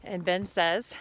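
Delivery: ordinary talk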